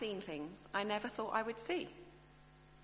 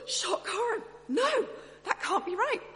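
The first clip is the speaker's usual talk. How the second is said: hammed-up horror, much higher and louder; mock shock; 12 semitones higher, 8 dB louder than usual. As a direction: play the scandalised listener, high and loud. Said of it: "We're like".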